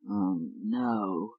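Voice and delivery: low quiet pained groan. Acted. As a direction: groan it, feeling sick. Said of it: "he groaned".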